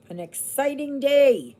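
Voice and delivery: high, loud mock excitement; sarcastic cheer, pitched high and loud; mock excited. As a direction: oversell a dull day as thrilling. Mock enthusiasm about dull day.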